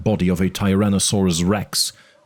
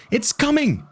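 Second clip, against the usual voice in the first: put-on high, frantic kid's cry; hysterical boy. A narrator's reading. Arby panics over the radio as the beast comes, high and frantic. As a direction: panic pouring out of him. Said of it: "He sounds completely hysterical".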